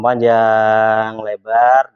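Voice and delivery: PANJANG stretched out long on a level note; drawn-out emphasis. Shown as a demonstration. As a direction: hold the vowels in "panjang" to show length. Menggambarkan penjelasan yang panjang. (kata "panjang" diulur panjang)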